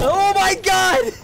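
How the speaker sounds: high, slow frightened yell; scared shout. Real, not acted. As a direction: cry out in real alarm. Still trapped in the machine.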